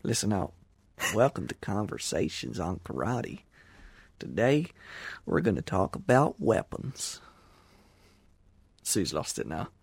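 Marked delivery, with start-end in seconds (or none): 1.2-7.2 mock-Texan show intro accent; butchered American accent. Host previews a whole episode in that accent, mock American accent. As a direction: announce it in a bad, drawn-out Texas accent. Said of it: "do a whole episode in that accent".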